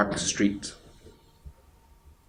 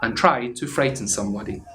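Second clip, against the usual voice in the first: plain reading voice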